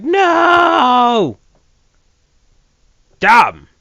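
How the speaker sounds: loud hammed-up disappointment; over-the-top mock upset; mock overacted dismay. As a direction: ham up the despair, shouting. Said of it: "sound a little bit more disappointed".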